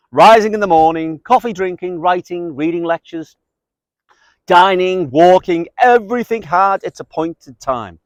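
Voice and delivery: lifted, emphatic literary recitation; quote reading. Acted. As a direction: declaim it like an over-the-top biography. Reads a long quote aloud.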